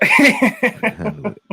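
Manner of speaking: high, slow sobbing laugh; crying laugh. Real, not acted. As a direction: cry and laugh at once. Crying friend, laughing cries.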